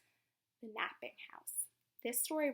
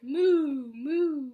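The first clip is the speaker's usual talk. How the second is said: mock cow moo; cow muttering; 4 semitones higher, 12 dB louder than usual. The confused cow moos, lightly hammed-up. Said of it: "who muttered".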